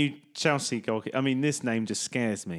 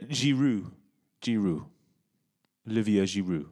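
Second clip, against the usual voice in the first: French accent; 6 semitones lower than usual. Shown as a demonstration. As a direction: say Giroud with a French touch. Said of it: "I added the French bit in".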